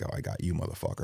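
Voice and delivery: low knowing mutter to himself; low quiet got-you; grim muttered realization. Retold or imitated. Grim realization under breath.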